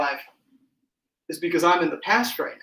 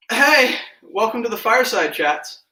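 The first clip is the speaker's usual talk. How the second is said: bright host welcome, a bit louder; show host intro; 5 semitones higher, 4 dB louder than usual. Acted.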